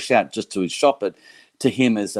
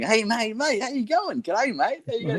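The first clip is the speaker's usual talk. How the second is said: hammed-up broad Australian greeting, pitched way up; crazy Aussie; 3 dB quieter than usual. Greeting Frankie in his skate shop, mock broad Australian accent much higher. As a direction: play an over-the-top Aussie bloke saying g'day. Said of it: "He saw this crazy Aussie going".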